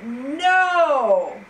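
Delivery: loud, mocking shout of no; gleeful shout. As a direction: shout it out like a gloating imp. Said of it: "laugh a little louder and shout".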